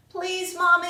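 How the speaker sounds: begging. A narrator's reading. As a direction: plead with mommy in a child's story voice. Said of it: "I begged".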